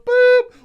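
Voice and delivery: high, loud horn boop; imitated car horn. Shown as a demonstration. Driver doing impression of the Kia Soul, high boop. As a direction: mimic a little horn going boop. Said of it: "This is my impression of the Kia Soul".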